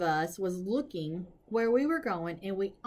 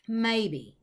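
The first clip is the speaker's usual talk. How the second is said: usual speaking voice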